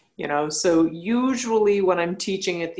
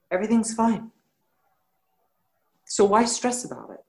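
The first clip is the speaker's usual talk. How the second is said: ordinary delivery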